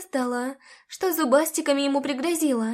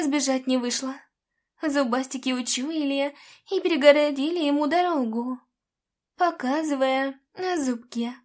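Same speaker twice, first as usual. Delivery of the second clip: plain reading voice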